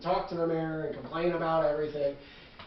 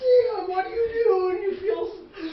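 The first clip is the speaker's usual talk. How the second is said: high flustered shriek; 13 semitones higher, 8 dB louder than usual. Acted. Acting flustered.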